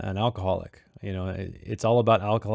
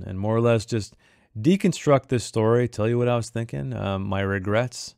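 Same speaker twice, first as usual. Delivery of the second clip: ordinary delivery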